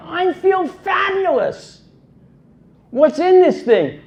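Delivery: high, giddy burst of joy; excited Kennedy. Retold or imitated. Kennedy after the injection, high and thrilled. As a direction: crow it out, suddenly feeling great.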